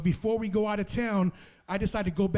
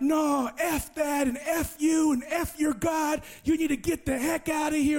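furious shout; 7 semitones higher than usual. Retold or imitated. Bald friend Jesus yells at Marco to get out, furious shout. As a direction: bark him out of the house in a rage.

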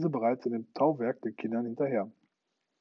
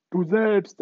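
calling out; 8 semitones higher, 9 dB louder than usual. Acted. Peter calls down from sky.

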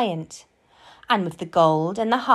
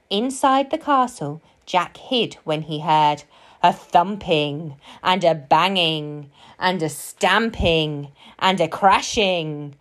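usual speaking voice

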